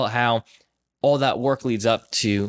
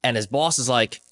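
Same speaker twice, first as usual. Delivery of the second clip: normal talking voice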